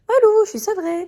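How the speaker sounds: loud, high-pitched celebratory cry; elated exclamation. Real, not acted. Vlogger announcing she is weaned off, in a loud elated cry. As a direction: shout it out in triumph, pitch way up.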